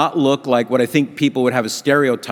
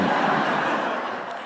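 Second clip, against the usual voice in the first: soft laugh; 7 dB quieter than usual. Real, not acted. Joke about the small tip.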